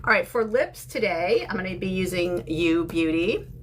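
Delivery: normal talking voice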